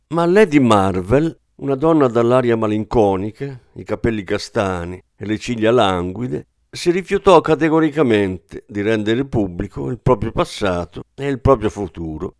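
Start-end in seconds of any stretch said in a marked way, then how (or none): none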